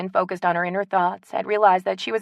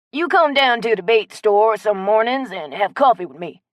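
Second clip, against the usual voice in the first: put-on louder, friendly old-timer; old Cajun man; 3 semitones higher, 7 dB louder than usual. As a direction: invite her over like a neighbor. Chet inviting Morgan.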